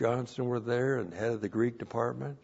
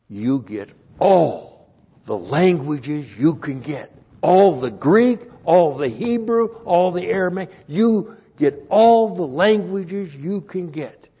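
booming, slow preacher's exhortation, much higher; pastor's loud advice; 8 semitones higher, 11 dB louder than usual. Retold or imitated. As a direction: thunder it out like an old pastor. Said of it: "took me aside and said".

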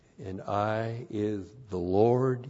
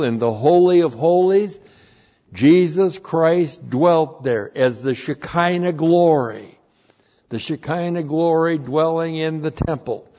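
louder and higher, driving each phrase home; preaching at full force; 6 semitones higher, 6 dB louder than usual. Acted. Pastor preaching to his congregation, loud and rising. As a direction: preach it out, building.

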